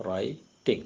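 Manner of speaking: "writing" split slowly into two syllables with a pause between; syllable breaks. Shown as a demonstration. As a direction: say "writing" in two separate units, write... ting. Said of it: "Two units".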